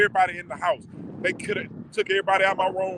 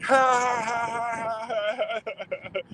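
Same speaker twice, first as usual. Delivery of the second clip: imitated laughing mid-cry; 6 semitones higher, much slower than usual. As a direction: re-enact a long laugh breaking out of crying. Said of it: "I started laughing in the middle of my cry".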